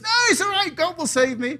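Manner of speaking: stubborn man trusting God; breezy refusal. Acted. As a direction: shrug off the firemen.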